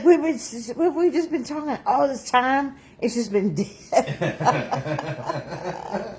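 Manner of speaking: laughing it out, the last words dragged slowly; laughing disbelief. Retold or imitated. Retelling husband's complaint about the dead phone, laughing. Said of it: "He's like".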